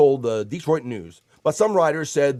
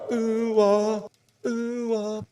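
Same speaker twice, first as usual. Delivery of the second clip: mock ooh-ah; 10 semitones higher, much slower than usual. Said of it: "playful monkey noise".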